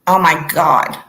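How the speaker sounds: loud, sudden oh my God; jolted by a blaring noise, blurt it out loud; startled outburst. Real, not acted. Host startled by the laptop's crazy noise, loud outburst.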